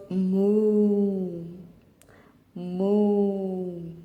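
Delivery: slow, drawn-out mooing like a cow; playful cow impression. Shown as a demonstration. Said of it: "I'm gonna be pointing at the pictures".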